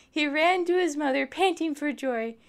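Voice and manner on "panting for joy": ordinary delivery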